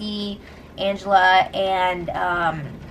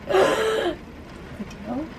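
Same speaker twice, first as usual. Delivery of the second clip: gasp of shock; 3 semitones higher, 6 dB quieter than usual. Real, not acted. Host catching Swaggy's slip, gasped shock. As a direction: gasp and ask it in disbelief.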